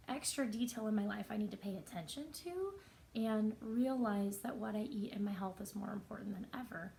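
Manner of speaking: usual speaking voice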